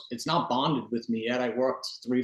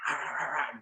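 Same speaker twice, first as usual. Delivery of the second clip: nasty puppy imitation; 3 semitones higher, 6 dB quieter than usual. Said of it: "nasty little".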